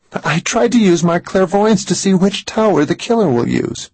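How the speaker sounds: high, distressed woman's voice; agitated Mary. A narrator's reading. Frightened woman explains her clairvoyance to Max, agitated. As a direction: pitch it up high, upset and urgent.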